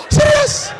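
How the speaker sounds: hammed-up outrage at the post; mock shocked gasp. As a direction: gasp then a very high, loud serious. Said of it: "you show the person".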